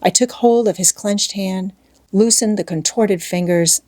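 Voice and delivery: plain reading voice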